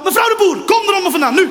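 high, loud call under a car; calling cats out. Pretend. Owner calling cats out from under a car, high and loud. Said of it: "Zo onder een auto".

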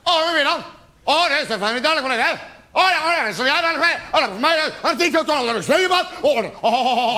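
high, jabbering mock interrogation; hammed-up Gestapo grilling, pitched high; Gestapo interrogator. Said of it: "onderwerp je je vriendin aan een Gestapo-verhoor".

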